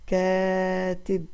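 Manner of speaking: كاتب with the long aa held very slowly; teacher drawing out the long vowel; stretched syllables. Shown as a demonstration. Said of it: "مع أي مد سمعنا صوتها".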